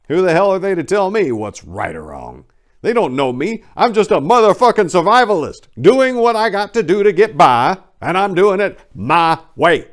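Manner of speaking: furious survivalist boast, shouted; defiant rant. Acted. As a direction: rail against anyone who'd judge you. Defiant rant in memoir reading.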